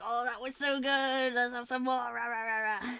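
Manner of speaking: gleeful babble trailing into gibberish; greedy brain voice. Acted. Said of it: "then my brain's like".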